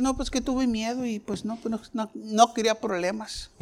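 imitating someone backing out; sheepish excuse. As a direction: make a feeble, hesitant excuse. Acts out someone's timid excuse.

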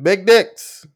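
loud, high, cheeky call into the mic; prank shout. Acted. Pranking the drive-thru worker, loud cheeky shout. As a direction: yell the prank so the clerk hears.

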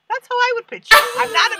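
high, loud, gleeful declaration; excited. Real, not acted. Coach declaring her pitch to the actress, high and loud. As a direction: crow it with delight.

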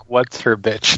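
sugary voice full of venom; sarcastic, dripping venom. Acted. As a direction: sneer the name sweetly. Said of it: "That is dripping with venom".

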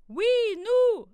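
high, loud chorus reply; animals answering. A narrator's reading. Mouse, hare and fox answering the wolverine, high loud chorus. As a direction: shout back together. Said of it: "répondirent la souris".

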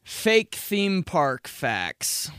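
very slow, loud segment title; slow announcer title. Shown as a demonstration. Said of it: "segment title".

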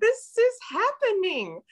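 very high, slow, drawn-out disbelief; retold mock terror, hanging on the words; stunned panic. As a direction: let the shock stretch each word. Retold panic at child ghost.

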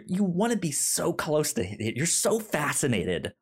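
ordinary talk

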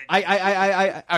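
stuttering mock imitation of a friend; imitating Brian's stammer. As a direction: mimic his stammering start. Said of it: "That's what I say".

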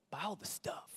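whispered aside. Real, not acted. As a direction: drop to a whisper to draw them in. Sermon aside, dropping voice.

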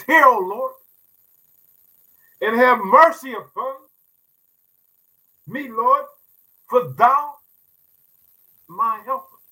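high, loud pleading psalm; preacher pleading the psalm aloud; impassioned scripture reading. Acted. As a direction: cry the verse out to God.

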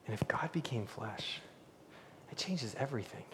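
whispered wonder; hushed whisper. Real, not acted. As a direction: drop to a whisper. Whispered turn.

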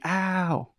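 high, soft cry of pain. Shown as a demonstration. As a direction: yelp ow like you just got hurt. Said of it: "Imagine you hurt yourself and you're going".

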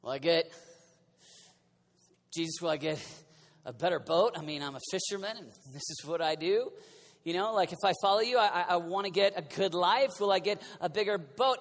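low-key bargaining with Jesus; Peter the fisherman. Pretend. Peter bargaining with Jesus for a boat, lightly voiced. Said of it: "Peter goes".

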